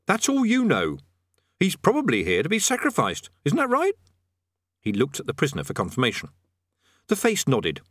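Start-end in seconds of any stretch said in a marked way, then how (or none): 0.0-3.9 pitched-up, argumentative retort; lightly voiced man, irritated; Rincewind, testy. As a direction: argue back, then appeal for support.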